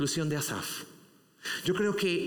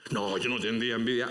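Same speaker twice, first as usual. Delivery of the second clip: lightly voiced defensive believer; a hint of a smug denial; mock denial; 4 semitones lower than usual. Preacher imitating an envious listener, mild mock denial. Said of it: "Ah, claro que sí".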